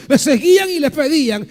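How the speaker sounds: usual speaking voice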